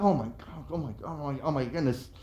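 soft, low, fearful murmur; mock panic. Watching girls on the tower, he murmurs in fear. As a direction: whimper it like you can't look.

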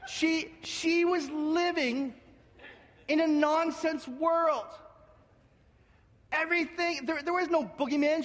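high-pitched, insistent sermon delivery; impassioned preaching. Real, not acted. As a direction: preach it with emphatic, mounting insistence. Preaches with rising intensity.

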